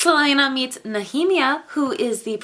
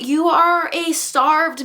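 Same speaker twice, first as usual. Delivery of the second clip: ordinary talk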